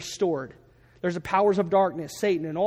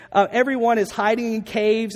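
ordinary talk, nothing marked

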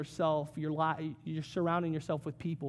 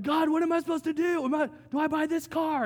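high, louder, fretful plea; anxious pleading; 10 semitones higher, 5 dB louder than usual. Retold or imitated. Worried believer asking God about a car, high fretful plea. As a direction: beg heaven like a nervous car shopper.

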